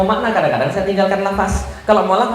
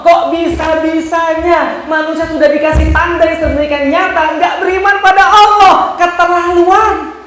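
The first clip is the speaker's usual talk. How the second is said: high, incredulous reproach, building loud; outraged jibe ending in a drawn-out verdict; scathing sarcasm; 8 semitones higher, 5 dB louder than usual. Acted. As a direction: marvel indignantly at their disbelief. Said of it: "Itu sindiran Allah".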